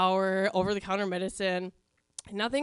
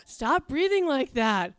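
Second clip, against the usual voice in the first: slow, louder, fed-up order; irritated demand; 5 semitones higher, 5 dB louder, slower than usual. Retold or imitated. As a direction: drag out each word in annoyance. Annoyed sister in bed.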